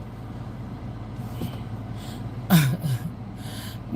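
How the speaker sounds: soft, breathy, drawn-out laugh; real voiceless chuckling; quiet laugh. Real, not acted. Streamer amused by talk of a little boy, laughing softly.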